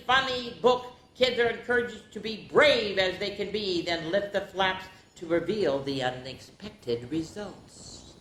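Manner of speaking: playful scary announcer; dramatic reading. Acted. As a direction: read it like a scary trailer for kids. Reads back cover dramatically.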